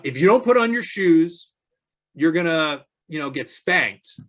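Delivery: a mock threat to scare the kid; threatening parent. Parent threatening the child over the shoes, loud then fading. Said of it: "The parent threatens".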